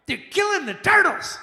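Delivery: high, loud mocking echo; mocking the turtle excuse, high and loud. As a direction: throw the line back in a mock-outraged squeal. Mocking bartender's claim.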